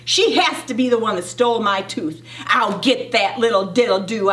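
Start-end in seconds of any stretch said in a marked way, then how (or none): none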